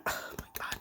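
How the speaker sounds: hushed horrified whisper; breathless whisper; whispered oh my God. Real, not acted. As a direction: drop to a whisper, appalled. Horrified aside in retelling.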